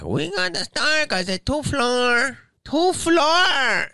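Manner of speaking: very high, loud mock maid voice; squeaky high caricature of the insistent maid; maid insisting. Maid telling the guest she must clean, loud high mock voice. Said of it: "And she's like".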